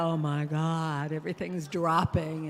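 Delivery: slow, low, loud mock horror; mock dismay. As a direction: groan at your reflection in exaggerated dismay. Acts out dismay at mirror.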